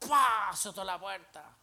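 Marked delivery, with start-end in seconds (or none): 0.1-0.5 loud, high "pah" bang; vocal imitation of a door slamming; sudden explosive burst; door slam noise.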